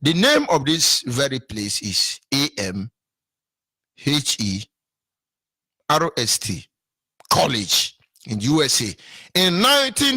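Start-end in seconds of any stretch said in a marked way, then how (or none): 0.0-6.7 "A M H E R S T" spelled letter by letter, slow. Shown as a demonstration. Spelling the college name for listeners, slow and deliberate. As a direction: spell it out with pauses. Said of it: "let me spell it so you can".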